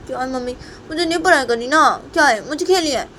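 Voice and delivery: put-on high, protesting little-kid voice; whining child. Sonam tells mother she wants to play, whining.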